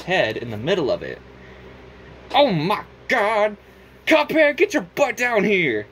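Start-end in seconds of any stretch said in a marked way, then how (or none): 2.3-5.9 higher, louder, slow shocked call; shocked shout. Acted. Elder Kettle calling Cuphead down, shocked shout. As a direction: yell up to him aghast.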